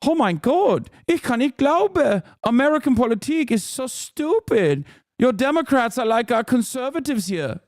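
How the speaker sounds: high-pitched mock German accent, half in German; whiny, high European caricature with a German accent. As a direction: mimic a scandalized German in a high voice. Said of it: "They like saying stuff like".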